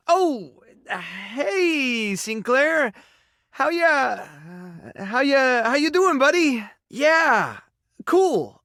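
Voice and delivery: high-pitched, halting, over-friendly hello; awkward greeting. Acted. Greeting Sinclair and fumbling how ya doing, awkward. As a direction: stumble through a chummy greeting, voice up high.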